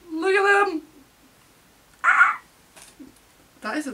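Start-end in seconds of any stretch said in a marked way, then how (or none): 0.1-0.8 loud, high fangirl cry; excited squeal. Real, not acted. Squealing over group photo. 2.0-2.4 short, very high yelp; excited shriek. Real, not acted. Screams at the photo.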